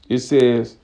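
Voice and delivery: ordinary delivery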